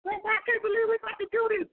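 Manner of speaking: high, mock girly disbelief; coy girl. Mocking a date feigning disbelief, high girly voice. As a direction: imitate a woman acting surprised it's happening. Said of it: "And it's just like".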